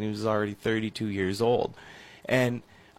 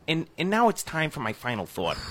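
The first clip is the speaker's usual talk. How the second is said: Jerry Springer impression; 5 semitones higher than usual. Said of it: "that was a little better".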